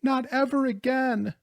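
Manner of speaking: high-pitched acted wail; tearful sobbing. A narrator's reading. Rosarita despairing of seeing Louis, sobbing. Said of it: "she sobbed".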